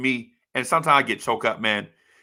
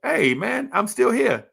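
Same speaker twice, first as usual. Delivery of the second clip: high, bright "I'm still here"; lightly voiced brother, relieved and upbeat; relieved waking up; 9 semitones higher than usual. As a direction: greet the morning like a small win. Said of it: "you wake up in the morning and you're like".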